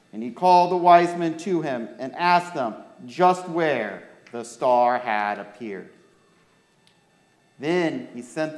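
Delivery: put-on clear, lively storytelling for children; story reading. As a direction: read the Christmas story to the kids. Reads Bible story to children.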